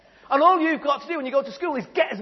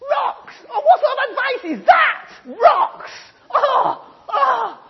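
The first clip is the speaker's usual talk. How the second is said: put-on much higher, loud scoffing kid; disgusted disbelief; 14 semitones higher, 8 dB louder, much slower than usual. Jimmy mocking the genie's advice, high and loud. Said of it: "Jimmy thinks".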